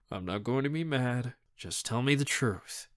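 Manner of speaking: soft, level and toneless; flat. Acted. Uncle presses Raz for the truth, said flatly. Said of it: "he says flatly".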